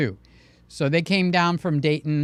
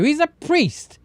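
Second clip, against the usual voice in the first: normal talking voice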